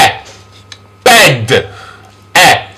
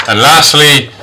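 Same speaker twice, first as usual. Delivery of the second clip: ordinary talk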